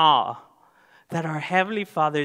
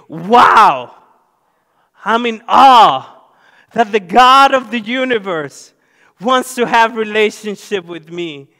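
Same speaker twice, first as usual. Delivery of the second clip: awed exclamation; 6 semitones higher, 11 dB louder than usual. Pretend. Believer voiced by the pastor marvelling at God, loud awed cry. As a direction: cry out in wonder as a humbled believer. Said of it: "humble us and say".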